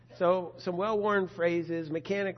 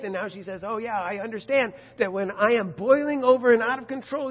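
preaching at full force; 4 semitones higher, 10 dB louder than usual. Acted. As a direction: drive it home to the congregation.

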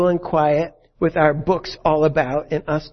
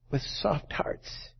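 whispered, slow and soft; whispered tenderness; 11 dB quieter, much slower than usual. Real, not acted. Preacher urging the congregation, hushed whisper. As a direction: breathe it like a secret.

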